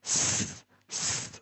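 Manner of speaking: স as a long unvoiced hiss. Shown as a demonstration. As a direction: hiss স with tongue behind the teeth. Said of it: "সা sound". (ফিসফিস করে স ধ্বনি)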